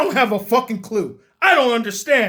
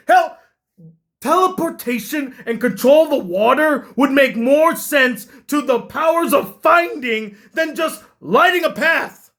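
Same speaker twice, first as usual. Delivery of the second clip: normal talking voice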